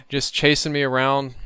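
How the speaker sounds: ordinary delivery, nothing marked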